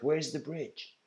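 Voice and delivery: a hint of the eager pupil; eager seeker. Seeker asks for bridge.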